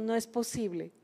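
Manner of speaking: hushed, soft disbelief, barely voiced; dismayed murmur. Retelling the cancelled flights, a soft dismayed murmur. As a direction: mutter it under your breath, stunned.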